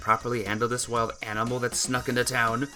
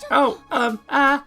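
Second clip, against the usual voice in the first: high, loud flustered hesitation; faltering; 11 semitones higher, 8 dB louder, slower than usual. Acted. Mother trying to ask something from behind, high faltering. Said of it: "I hear her faltering".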